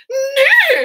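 high, loud whinny; rude horse neigh. Shown as a demonstration. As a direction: give a long rude neigh. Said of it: "A rude horse's noise".

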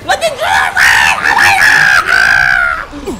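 loud, distorted roaring scream; all-out scream. Pretend. Gamer fleeing on adrenaline, all-out scream. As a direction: scream it with everything you have.

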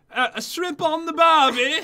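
put-on Australian accent with a laugh; mock Australian accent. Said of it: "I'm Australia now".

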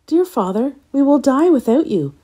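high, pleading storybook wail; plaintive wail. A narrator's reading. Trees and plants implore their dear father, wailing plaintively. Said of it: "wailed the trees and plants".

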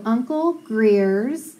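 "Uncle Greer's" read very slowly. Shown as a demonstration.

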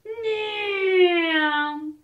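loud high shouted zoom noise; a child playing aeroplane, full blast. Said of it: "went Baby Bear".

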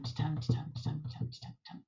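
ta-tum beats fading away to very soft; steady iambic rhythm. Shown as a demonstration. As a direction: keep "tum" stressed as the pulse dies down. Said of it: "That's the rhythm I'm hearing".

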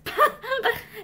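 short high laugh; a careless little laugh; light laugh. Acted. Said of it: "Violet laughed".

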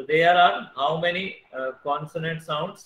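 slow, drawn-out question to the class; patient teacher question; slow quizzing. Shown as a demonstration. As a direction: draw the answer out of students. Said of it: "quizzing the class".

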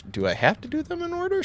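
squeaky rushed question; high fast whine. Pretend.

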